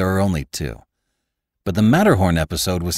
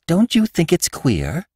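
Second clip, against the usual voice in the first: higher, slower wife's voice; wife's worried question; 11 semitones higher, slower than usual. Acted. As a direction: ask it as the uneasy wife, pitched up. Wife voiced by narrator.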